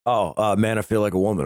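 usual speaking voice

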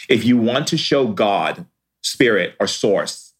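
ordinary delivery

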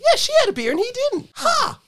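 high-pitched, rapid indignant outburst; mock outrage. Real, not acted. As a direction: rush it out high, capped with a scoffing "Ha!". Mock outrage over the untouched beer.

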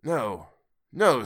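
put-on old man begging the spirit; pleading Scrooge. A narrator's reading. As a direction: beg as the fear rises. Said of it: "said Scrooge".

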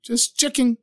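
high, small voice covering up; shocked, flustered. A narrator's reading. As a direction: brush it off in a high, sheepish voice. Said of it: "was so shocked".

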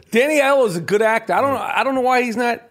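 ordinary talk, nothing marked

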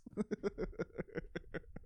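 breathy giggle; soft airy laugh to self; a quiet unvoiced giggle. Real, not acted. Giggling at own goofiness.

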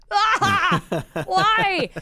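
high, loud wail of dismay; mock anguished cry. Retelling arriving as the show finished, mock anguished cry. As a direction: cry out in exaggerated despair, half laughing.

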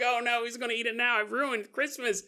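lightly played fretful victim; mock dismay. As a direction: ham up a silly victim's dismay. Mock bleeding victim whining.

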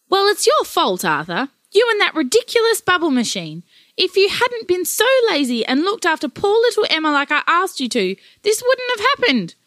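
high, loud accusing rant; wife blaming her lazy husband; angry blaming. Acted. Grandparents quarrel over lost child.

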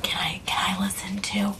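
hushed, breathy question; whisper. Acted. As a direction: whisper it so nobody else hears. Whispering to join the story.

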